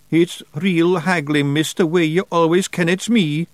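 slow, high Scots pixie voice; sheepish wee Scots pixie; Feagle voice. Acted. Daft Woolly answering.

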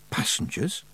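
hushed, breath-only question; horrified whisper. A narrator's reading. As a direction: whisper it in dread. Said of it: "Tiffany whispered in horror".

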